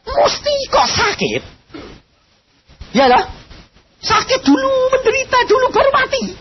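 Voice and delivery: high, strained cry of a preacher; high pleading preaching. Acted. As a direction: plead it at the top of the voice. Preacher pleading at full force. (khotbah melengking penuh ratapan)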